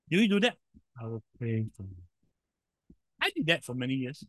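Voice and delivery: usual speaking voice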